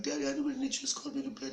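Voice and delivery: put-on high, rushed little-kid voice; fast falsetto pleading; pleading scared child. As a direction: beg daddy urgently in a squeaky kid voice.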